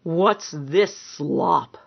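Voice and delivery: slow, drawn-out sneer at the food. A narrator's reading. Big man shoving his plate away, sneering. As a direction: sneer it out slowly, with contempt. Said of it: "with a sneer".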